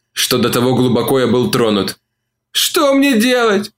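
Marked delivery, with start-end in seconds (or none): 2.5-3.7 high, slow lament; wailing. A narrator's reading. Olivia wails to Mark, high and slow. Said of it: "запричитала она".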